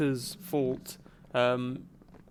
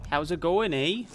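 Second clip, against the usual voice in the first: mock Canadian, pitched up with a rising "eh"; 3 semitones higher than usual. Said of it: "Canada is stereotyped with an accent".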